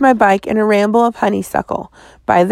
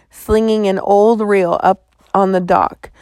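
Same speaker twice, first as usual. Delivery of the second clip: ordinary delivery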